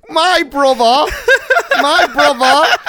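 high, drawn-out laughing mimicry; incredulous high-pitched mock Cockney; mocking bad impression. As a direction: parrot a terrible impression back through laughter. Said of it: "that is the worst impression anyone has ever done of me".

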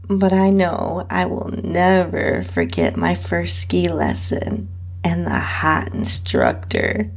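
ordinary delivery, nothing marked

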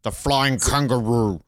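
slow, put-on slogan delivery; lightly hammed-up airline jingle line, spoken; mock slogan. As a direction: stretch it out like a slogan.

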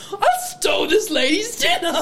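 high-pitched teasing imitation, laughing; laughing mock boast. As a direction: mimic a proud confession through giggles. Mocking the teller while laughing.

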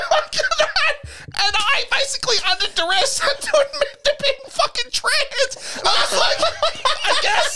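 high, laughing helplessly through the words; can't stop laughing while telling it; cracking up mid-story. Real, not acted.

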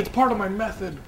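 pretentious actor voice. Retold or imitated. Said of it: "It's like".

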